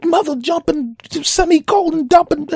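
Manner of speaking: high, loud, squealing mock cursing tirade; cursing rant. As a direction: rattle off censored curses in a shrill fury. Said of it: "He cursed a lot".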